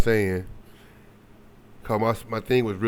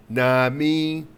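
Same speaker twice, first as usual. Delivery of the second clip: long drawn-out, raised movie quote; movie catchphrase; 4 semitones higher, 4 dB louder, much slower than usual. Acted. Quoting a comedy film to viewers, drawn-out catchphrase. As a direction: stretch it out slowly, higher and louder. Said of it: "that was on, um, Scary Movie".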